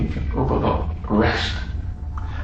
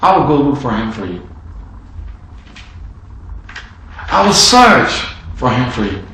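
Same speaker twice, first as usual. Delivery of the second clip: loud, high preaching voice; 4 semitones higher, 5 dB louder than usual. Acted. Preacher urging the church to console the Father, impassioned. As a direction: preach it out to the congregation.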